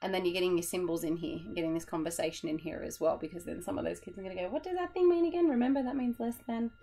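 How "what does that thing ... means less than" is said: put-on much higher, goofy kid voice; a giddy, loony classroom voice. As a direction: play it silly and high-pitched. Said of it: "I sound a bit loony tonight".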